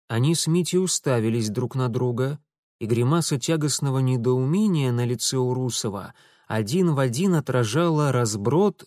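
ordinary delivery

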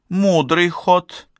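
high, drawn-out amused voice, mild; a touch of amusement, pitched well up; со смешком. A narrator's reading. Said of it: "рассмеялась Буря". (насмешливо, высоким голосом)

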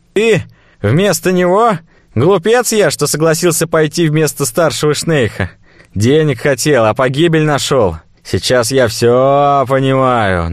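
cornered slaver, scoffing; nervous fat merchant. Acted.

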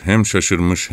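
ordinary delivery, nothing marked

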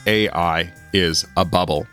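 usual speaking voice

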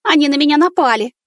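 high squeaky cry; squeaked. Acted. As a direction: squeak it out in alarm. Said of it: "пискнула Влада".

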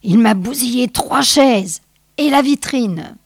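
angry voice. Acted. Angry man calls out damages from the back of the room, coléreux. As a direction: protest angrily from the crowd. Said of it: "une voix anonyme et coléreuse".

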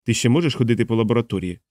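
usual speaking voice

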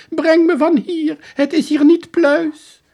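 high, loud, slow begging; pleading soldier. Acted. Soldier on his knees begging the detective, slow and high. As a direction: beg slowly, high and loud.

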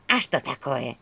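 low, mildly venomous question. A narrator's reading. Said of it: "ядовито произнесла Черепуша". (слегка ядовито, низко)

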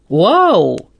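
loud, swooping wow; big astonished outburst; exclaiming. A narrator's reading. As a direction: exclaim it in amazement. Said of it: "воскликнула Кэт".

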